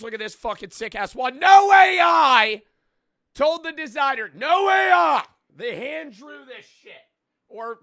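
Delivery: high, loud, hammed-up sales pitch; over-the-top hype man pushing his own merch; hyped merch ad read. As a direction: yell the merch plug like a pumped-up promo.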